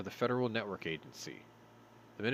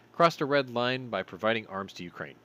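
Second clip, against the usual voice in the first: ordinary delivery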